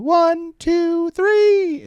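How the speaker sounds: put-on very high little-girl count. Daughter counting push-ups for the Ranger students, high-pitched. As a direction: call the cadence like a bossy child. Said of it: "little girl high-pitched voice".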